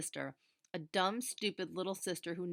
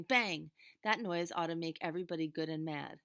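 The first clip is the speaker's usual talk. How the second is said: ordinary delivery, nothing marked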